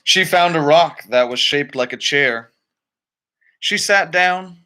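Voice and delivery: ordinary delivery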